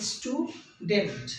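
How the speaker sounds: "স্টুডেন্ট" split slowly into স্টু and ডেন্ট; slow two-beat "স্টু - ডেন্ট"; syllable by syllable. Shown as a demonstration. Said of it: "এই যে স্টুটাও একটা syllable আর ডেন্টও একটা syllable".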